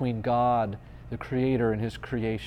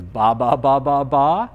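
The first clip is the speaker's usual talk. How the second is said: loud, bouncing run of syllables; loud mock-baby chant, rising at the end; imitated baby babble; 8 dB louder than usual. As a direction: mimic an infant babbling away insistently. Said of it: "And he says".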